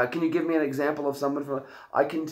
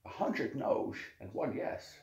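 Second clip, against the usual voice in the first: soft, husky Scottish impression; slushy-s Scottish star giving advice; Sean Connery; 5 dB quieter than usual. Host finishing the bit, hushed Sean Connery impression. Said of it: "Do Sean Connery".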